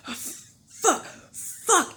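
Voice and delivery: high, gasped mock panic; imitated panic. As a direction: imitate a gunman startled into fleeing. Imitating the panicking gunman running off.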